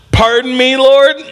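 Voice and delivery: high, querying mock disbelief; baffled Gideon. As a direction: ask it high, as if you misheard. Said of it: "that's what he says".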